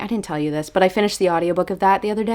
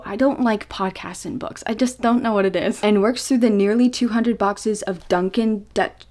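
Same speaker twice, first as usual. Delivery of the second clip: ordinary delivery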